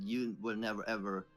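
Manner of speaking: ordinary talk, nothing marked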